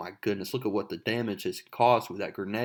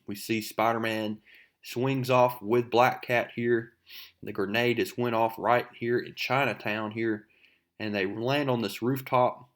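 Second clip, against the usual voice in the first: ordinary talk, nothing marked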